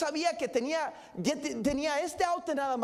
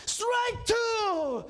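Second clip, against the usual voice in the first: shouted strike call; 8 semitones higher than usual. Retold or imitated. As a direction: bark it out like an umpire behind the plate. Umpire calls strike in game.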